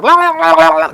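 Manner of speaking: high, loud mock screaming babble; imitated yelling. Uncle imitating his niece running wild, mock screaming. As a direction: imitate a kid screaming nonsense at full pitch. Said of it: "just yelling and screaming".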